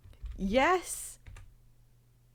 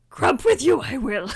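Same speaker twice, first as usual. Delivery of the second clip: Yoda. Acted. Streamer redoing the botched impression as Yoda, croaky voice. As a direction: croak it in a comic alien-sage voice. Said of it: "That was actually Yoda".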